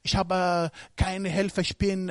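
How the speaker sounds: whimpering like a discouraged believer; mock weak believer. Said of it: "Du sollst nicht sagen".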